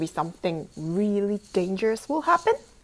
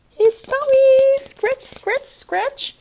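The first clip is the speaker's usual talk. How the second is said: high, slow, sing-song "scratch"; playful high coaxing; 13 semitones higher, 5 dB louder, slower than usual. Acted. Reader guiding a child to scratch Larry's tummy. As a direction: coax it out playfully.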